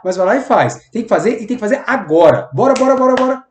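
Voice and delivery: loud, pushy, hurried orders; bossy demand. Shown as a demonstration. Boss rushing Júlio to bend the process, loud pushy orders. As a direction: bark urgent orders at a coworker. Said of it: "eu falo assim".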